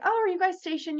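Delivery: high-pitched imitation of a little girl. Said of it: "there was a little girl playing".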